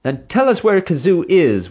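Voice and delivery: high, loud demand. A narrator's reading. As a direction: insist on an answer. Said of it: "security guards demanded".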